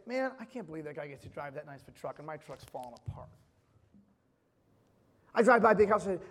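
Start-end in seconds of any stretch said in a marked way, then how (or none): 0.1-3.3 envious grumble. Retold or imitated. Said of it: "I still look around and say".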